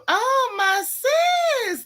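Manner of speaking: high, slow, stretched-out delighted hello; excited greeting. Real, not acted. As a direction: stretch it out like you just spotted your best friend. Greeting a close friend joining live.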